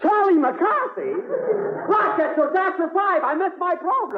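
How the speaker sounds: high, urgent shout from off; calling out in alarm. Acted. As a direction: yell across the house in dismay. Calls out, realizing he's late.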